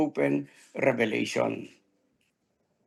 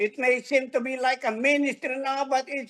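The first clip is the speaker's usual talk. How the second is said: preaching declaration; 7 semitones higher than usual. Shown as a demonstration. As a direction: press the words home in faith. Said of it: "Come on, say it". (masigasig na pagpapahayag)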